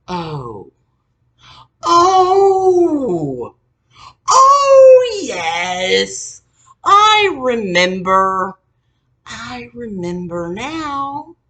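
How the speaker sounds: high, loud, slow hammed-up realization; mock dramatic remembering. Mocking mother finally remembering, hammed-up loud high voice. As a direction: overact the sudden "oh" of memory.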